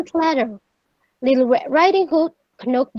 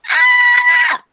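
very high, loud storybook scream; sustained high-pitched shriek; frightened scream; 7 dB louder than usual. A narrator's reading. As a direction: scream as the chased girl. Red chased by the wolf.

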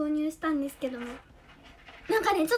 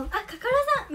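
ordinary delivery

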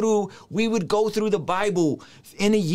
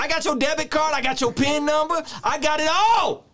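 mock preacher shout; 10 semitones higher than usual. Continuing the mock preacher bit.

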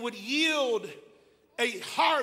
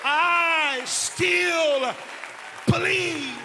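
higher and louder, broken in two; preacher repeating his belief even louder; shouted declaration; 7 semitones higher, 8 dB louder, much slower than usual. Real, not acted. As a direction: cry it out, pausing before believe. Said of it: "I boldly declare".

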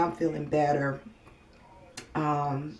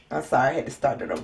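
normal talking voice

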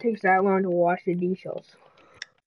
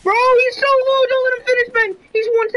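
much higher and louder, urgent; excited yelling; 11 semitones higher, 4 dB louder than usual. Real, not acted. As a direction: yell at your teammate to finish him. Urging teammate to finish enemy.

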